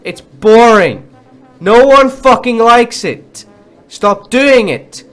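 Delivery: loud and high, slow furious yell at developers; real fury, slowed right down; angry shout. Real, not acted. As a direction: bellow it at them, word by word. Yelling at game developers.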